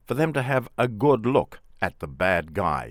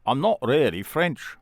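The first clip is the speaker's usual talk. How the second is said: put-on quick Manchester accent, pitched up; 6 semitones higher than usual. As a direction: drop the French act in broad Manchester. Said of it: "in a Manchester accent".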